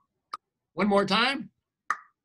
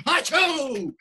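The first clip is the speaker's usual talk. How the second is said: high loud comic achoo; big fake sneeze; 8 semitones higher, 3 dB louder than usual. Wolf sneezing at the stick house, loud mock sneeze.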